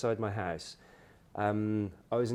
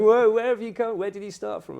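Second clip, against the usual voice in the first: high, louder whoa of disbelief; astonished shout; 11 semitones higher, 9 dB louder than usual. Retold or imitated. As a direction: call out stunned. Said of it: "He's going".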